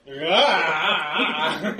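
loud pained orc shout; orc cry of pain. Acted. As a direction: stagger back with a loud yell. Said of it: "he staggers back and is like".

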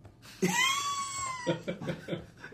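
high shrieking burst of laughter. Real, not acted.

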